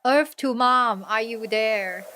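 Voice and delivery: playful loud, drawn-out call; mock hailing of an absent-minded mom. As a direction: call slowly to someone not responding. Calling out to unresponsive Mom.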